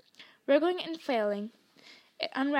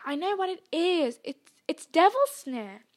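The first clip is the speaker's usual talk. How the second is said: higher, raised urgent voice; pressing, lifted tone; urgent order; 5 semitones higher, 5 dB louder than usual. A narrator's reading. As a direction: announce the danger urgently. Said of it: "Hermione ordered them".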